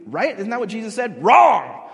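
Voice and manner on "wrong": sudden loud, high shout; emphatic rejection. Real, not acted. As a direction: shoot the idea down loudly. Preacher rejects the parody emphatically.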